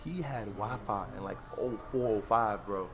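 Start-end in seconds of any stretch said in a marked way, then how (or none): none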